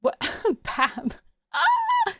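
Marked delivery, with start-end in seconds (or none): 1.5-2.1 sudden shrill squeal; high squeal. Real, not acted. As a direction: let out a startled squeal.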